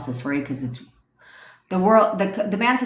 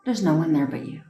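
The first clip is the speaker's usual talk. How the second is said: guided meditation. Acted. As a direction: guide them into calm visualization.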